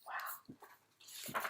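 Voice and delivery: breathless whispered wow; awed whisper. Real, not acted. Reading to kids, a whispered wow at the dragon. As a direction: hush it like the dragon scared you. Reader reacts to scary dragon.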